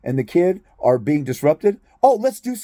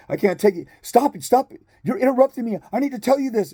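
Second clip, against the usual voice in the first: mimicking a frazzled client, higher pitched; whiny, higher-pitched protest from an overwhelmed caller; 6 semitones higher than usual. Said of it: "He's like".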